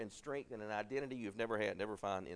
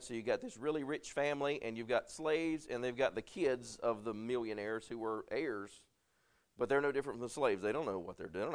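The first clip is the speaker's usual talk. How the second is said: ordinary talk, nothing marked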